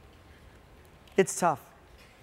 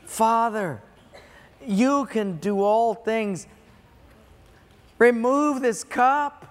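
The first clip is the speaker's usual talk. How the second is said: slow, raised cry of prayer to the Father; passionate plea; 4 semitones higher, 3 dB louder, slower than usual. Acted. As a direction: plead with passion, stretching each word out. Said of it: "Can you hear the passion".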